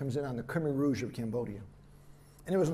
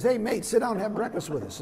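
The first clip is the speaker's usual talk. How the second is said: higher, louder imitation of an Australian greeting; Australian accent; 8 semitones higher, 7 dB louder than usual. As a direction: put on a hearty-volume Aussie accent, pitched up. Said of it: "I know the accent. So he says".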